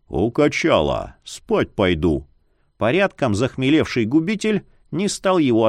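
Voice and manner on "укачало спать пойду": low, slow grumbling; gruff grumble. A narrator's reading. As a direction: mutter it off, unwilling to talk. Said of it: "буркнул Яр".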